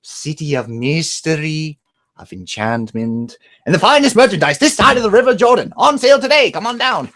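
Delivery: booming street peddler pitch. Retold or imitated. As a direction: sell it like a carnival merchant. Aladdin peddler opening impression.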